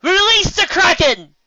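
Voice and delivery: loud, high, drawn-out battle cry; yelled. Acted. Lyra yells the famous line across the lake, loud and slow. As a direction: boom it out like a proclamation. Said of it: "she yelled".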